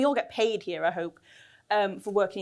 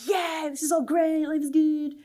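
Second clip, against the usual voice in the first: higher, mock-upbeat gushing; fake cheeriness; 4 semitones higher than usual. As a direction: pretend everything is wonderful, pitched up. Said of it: "It's not all about, like".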